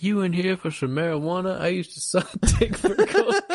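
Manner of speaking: high, hammed-up disbelief breaking into laughter; mock scornful doctor. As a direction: imitate a scoffing doctor, cracking up midway. Comic voicing of the scornful doctor.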